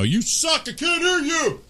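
very high squeaky heckler; whiny heckler. Retold or imitated. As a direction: squeal it like an online troll. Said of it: "you know".

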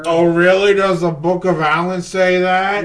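loud, mocking comeback; sarcastic challenge. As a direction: taunt the believer about his book. Player taunts the book's believer.

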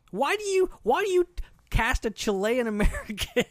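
high, loud disbelief breaking into laughs; incredulous laughing. Real, not acted. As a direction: laugh at the absurdity of it. Incredulous about casting choice.

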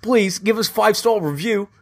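high, pleading fake Cockney; high-pitched makeshift Cockney; attempted Cockney. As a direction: beg in a badly faked Cockney accent. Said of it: "You gotta do Cockney".

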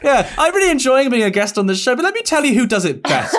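high-pitched, playfully put-on guest chat; mock podcast guest. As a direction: gush like a guest, in jest.

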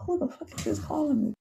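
normal talking voice

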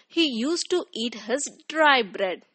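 plain reading voice

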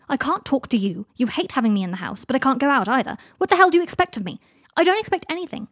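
rising in pitch and volume; exasperated quarrel. Acted. Housemates arguing over living together, exasperated. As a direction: vent it, building to a heated outburst.

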